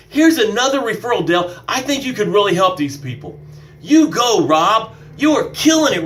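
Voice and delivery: high, punchy congratulations; enthusiastic cheering on. Acted. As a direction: cheer your friends on proudly, pitch soaring. Acted cheering on successful friends.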